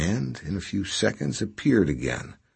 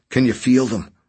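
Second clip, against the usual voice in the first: groaning; 5 semitones higher, 5 dB louder than usual. A narrator's reading. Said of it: "he groaned".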